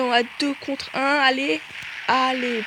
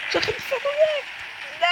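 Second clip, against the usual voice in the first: high panicked voice breaking; panicked high cry; voice cracking; faster than usual. Real, not acted. Getting killed in game.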